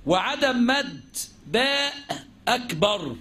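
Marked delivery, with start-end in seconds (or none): none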